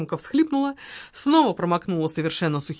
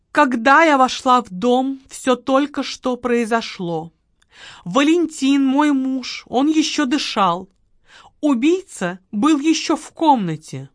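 higher, slow, mournful recital; staged sorrow in a raised voice; theatrical widow; 5 semitones higher, 5 dB louder, slower than usual. Acted. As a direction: perform the grief for the detective. Margarita repeats memorized testimony.

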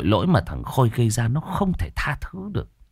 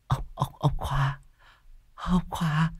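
put-on faint, breathless, higher stammering of a terrified old woman; 7 semitones higher, 7 dB quieter, slower than usual. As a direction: gasp the name out in horror, barely voiced. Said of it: "hoảng hốt nhìn anh Khiêm, đưa tay khua khoáng kích động". (bà Lành hoảng hốt lắp bắp gọi tên chồng)

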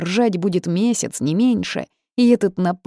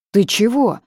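startled yelp of a house spirit; 6 semitones higher, 6 dB louder than usual. A narrator's reading. House spirit caught off guard, startled cry. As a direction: jump with fright mid-word.

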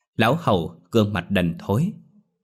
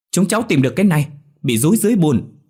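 high, loud call from the water; 8 semitones higher, 6 dB louder, faster than usual. A narrator's reading. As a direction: shout it up to the bank. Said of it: "nói vọng lên".

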